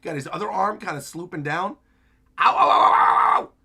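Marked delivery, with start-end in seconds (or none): 2.4-3.5 loud, high yelps of pain. Acted. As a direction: yelp like every move hurts. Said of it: "just screaming in pain once again".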